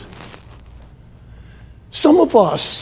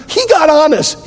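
shout; 9 semitones higher, 3 dB louder than usual. Real, not acted. Preacher shouts climax.

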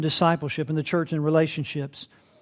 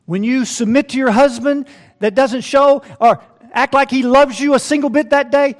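higher, louder pulpit emphasis; insistent, lifted preacher's delivery; raised preaching voice; 8 semitones higher, 8 dB louder than usual. Real, not acted. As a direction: press the hard case home, high and loud.

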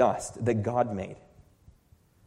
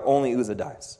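ordinary talk, nothing marked

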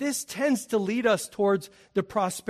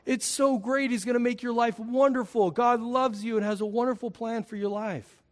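higher, slowed, gushing sales voice; cheesy sales pitch; 6 semitones higher than usual. Pretend. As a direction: oversell it like a salesman. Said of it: "We say".

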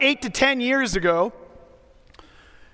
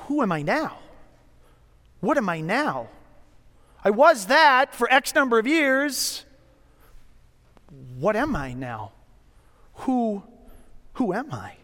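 wistful self-doubt. Pretend. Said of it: "you're saying".

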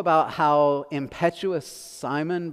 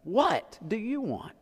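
WHAT drawn out and pitched high; stress on WHAT; 7 semitones higher, slower than usual. Shown as a demonstration. Pastor testing where the emphasis goes, stress on WHAT. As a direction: lean hard on "What", slowly. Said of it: "where do you put the emphasis here".

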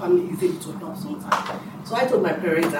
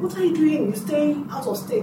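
retold shock; 10 semitones higher than usual. Retelling shock at brother leaving.

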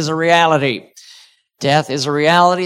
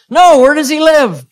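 put-on boy's question; 7 semitones higher, 5 dB louder than usual. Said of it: "He goes".

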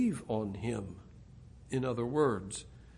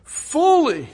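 higher, louder, slow and ringing; voicing Jesus, preaching; 8 semitones higher, 11 dB louder, slower than usual. Preacher voicing Jesus promising satisfaction, loud and high. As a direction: proclaim it as a promise. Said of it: "Jesus would say".

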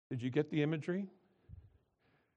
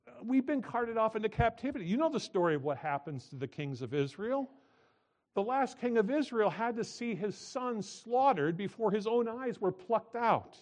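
preaching at full force; 9 semitones higher, 5 dB louder than usual. Acted.

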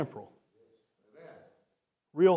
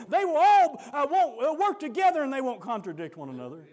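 preaching at full force; 10 semitones higher, 5 dB louder than usual. Acted. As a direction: whoop it up for the church. Preaching climax.

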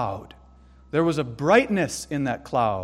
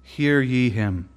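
hushed voice of God; 4 semitones lower, slower than usual. Acted. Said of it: "God proclaimed".

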